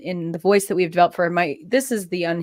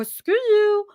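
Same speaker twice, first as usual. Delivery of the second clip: very high sassy dog voice; Pepper; 12 semitones higher, 4 dB louder than usual. Shown as a demonstration. Sassy dog talking back to owners, very high voice. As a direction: squeal it as a sassy diva pet. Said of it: "she's really sassy".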